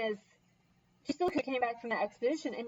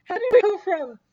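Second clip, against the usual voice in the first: higher, louder mock-incredulous question, easing toward the end; baffled disbelief; 4 semitones higher, 6 dB louder than usual. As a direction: act out bewildered disbelief at the chaos. Retelling her baffled reaction to chaos.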